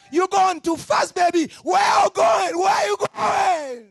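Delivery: high loud warning shout; calling out. Acted. Preacher calling to a young girl, high shout. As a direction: yell after her to slow down.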